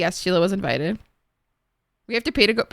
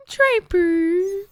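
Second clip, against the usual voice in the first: mock wail; 8 semitones higher than usual. Streamer mock-wailing emotionally.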